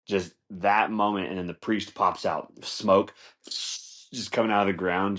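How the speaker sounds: normal talking voice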